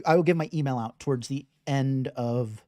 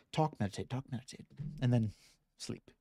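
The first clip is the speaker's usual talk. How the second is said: softer and softer, trailing away; fading toward sleep; 2 semitones lower, 9 dB quieter, faster than usual. Pretend. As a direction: let it fade out as if nodding off. Jokes the routine ends in sleep.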